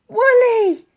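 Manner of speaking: high, loud cry of a name; spluttered call. Acted. Elsie calling to the sinking mammoth, loud. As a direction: call out desperately to her friend. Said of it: "she spluttered".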